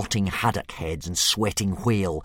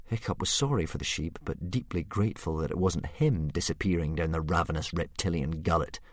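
plain reading voice

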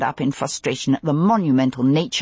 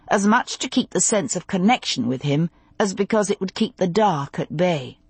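ordinary delivery

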